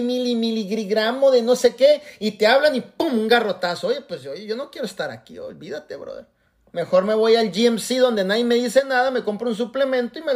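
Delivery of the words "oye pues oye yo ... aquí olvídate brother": mumbled, much softer, trailing away; sulky muttered quitting. Retold or imitated. Scolded member muttering that he is leaving, softly. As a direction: grumble it under your breath like a scolded member.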